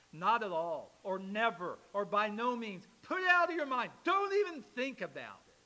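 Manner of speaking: loud, rising volley of refusals; emphatic repudiation. Retold or imitated. Preacher listing translations of Paul's phrase, emphatic repudiation. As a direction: slam each phrase down, pitch climbing high toward the end. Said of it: "Paul's most emphatic repudiation".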